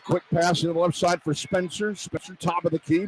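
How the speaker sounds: ordinary talk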